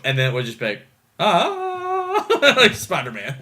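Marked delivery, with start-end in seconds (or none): 1.2-2.2 high sustained "ah"; imitated transformation wail. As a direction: hold a mock heroic wail.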